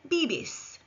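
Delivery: "bibis" with b sounds, falling; model Spanish verb. Shown as a demonstration. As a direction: say "bibis" clearly for learners. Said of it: "Repeat after me".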